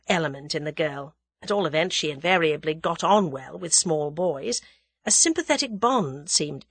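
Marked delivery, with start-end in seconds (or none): none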